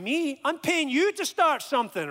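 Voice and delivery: high-pitched, affronted inner outburst; arrogant indignation. Retold or imitated. Arrogant student inwardly scoffing at tutor's suggestion, indignant. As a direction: push back in offended disbelief. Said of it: "I was arrogant".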